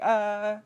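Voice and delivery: high, loud imitated grown man's scream; mock scream. Adult Ben jolted out of his memory, high mock scream. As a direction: cry out "ah" on a held high note. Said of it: "adult Ben's all like".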